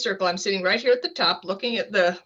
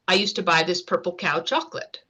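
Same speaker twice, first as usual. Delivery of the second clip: normal talking voice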